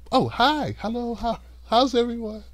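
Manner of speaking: high, soft, sugary greeting; sweet public voice. Acted. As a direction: switch to the nice smiling politician. Sweet public face again.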